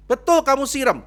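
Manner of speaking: loud, high-pitched accusing question; acted interrogation. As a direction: fire the accusation at full volume. Acted pastor interrogates the husband.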